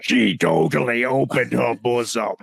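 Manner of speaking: mock horror-villain audiobook voice; Pinhead. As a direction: declaim erotica as a movie demon. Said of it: "I wanna do it like Hellraiser".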